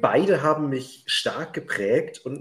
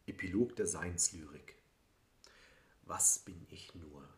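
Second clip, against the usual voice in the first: poem recitation; 3 semitones lower, 6 dB quieter than usual. Acted. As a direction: recite it like a quiet lyric poem. Poem read aloud.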